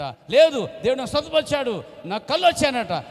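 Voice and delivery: high, loud joyful insisting; insistent shout. Retold or imitated. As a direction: shout it so everyone hears. Insisting to the barber. (కళ్ళు వచ్చాయని గట్టిగా అరుపు)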